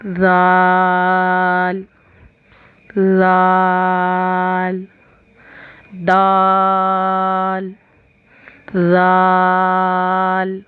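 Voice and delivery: जाल लाल दाल जाल each held very long and slow; slow recitation of the letter names; letter drill. Shown as a demonstration. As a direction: recite each word slowly, stretching every sound. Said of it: "सा को पढ़ते थे उसी तरह".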